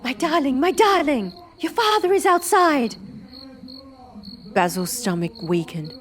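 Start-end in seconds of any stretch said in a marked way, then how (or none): 0.0-3.0 high panicked warning; urgent, frightened alarm; frantic. Acted.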